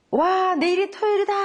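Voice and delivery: high, loud cheer of delight; excited child. Shown as a demonstration. Child cheering to parent about Saturday, high and excited. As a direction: shout it out like a thrilled kid. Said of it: "예를 한번 들어보세요".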